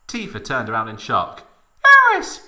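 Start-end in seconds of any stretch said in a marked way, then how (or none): 1.8-2.5 high, loud called name; similar soprano cry. A narrator's reading. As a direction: cry out in shrill falsetto. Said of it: "she cried in a similar tone".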